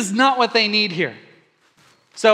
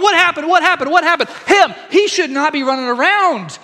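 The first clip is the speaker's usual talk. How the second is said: higher, louder bewildered asking; excited crowd; 6 semitones higher, 5 dB louder than usual. Retold or imitated. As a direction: tumble out the questions like amazed onlookers.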